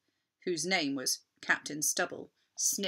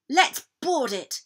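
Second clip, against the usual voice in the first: higher, louder battle call; cried out; 8 semitones higher, 7 dB louder than usual. Acted. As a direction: urge everyone on. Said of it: "cried Captain Flynn".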